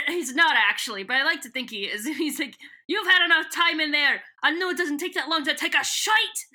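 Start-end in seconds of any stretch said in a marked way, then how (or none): 2.9-6.4 put-on higher, louder bossy guard with an accent; Scottish-ish accent. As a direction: bark it like an impatient Scottish guard. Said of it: "it's even got the accent".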